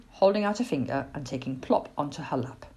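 plain reading voice

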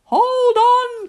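high, loud rescuing shout; urgent mum's call. A narrator's reading. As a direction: holler that help is coming. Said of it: "called Mum".

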